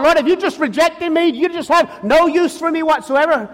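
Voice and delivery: anguished questioning of the Lord; desperate prayer. Acted. Preacher voices desperate prayer.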